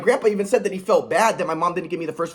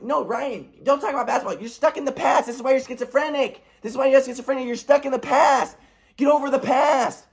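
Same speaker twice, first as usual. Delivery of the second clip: high-pitched imitation of a nagging mom; pitched-up, insistent mock telling-off; mocking scold; 6 semitones higher than usual. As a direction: mimic a mother's exasperated harping. Retold mom's repeated scolding.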